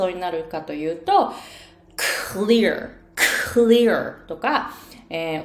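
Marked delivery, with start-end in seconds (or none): 2.3-4.3 louder clear, exploding the C with breath; 思いっきりの発音. Shown as a demonstration. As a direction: clear with a strong, long burst on the C. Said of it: "思いっきり発音してみて". (思いっきり息を出すclear)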